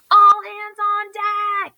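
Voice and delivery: loud, high, slow pirate bellow. A narrator's reading. Earl the pirate ordering the crew, a slow loud bellow. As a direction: roar the order like a captain. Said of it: "bellowed Earl".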